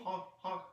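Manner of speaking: half-hearted honking clown horn. Pretend. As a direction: honk like a clown horn, without much effort. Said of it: "that was a clown honk".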